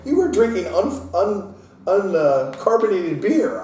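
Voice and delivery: high, loud sputtering disbelief; stunned outrage. Retold or imitated. Dad realising his kid drank flat beer, sputtering. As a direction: stammer it out, aghast. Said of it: "I'm like".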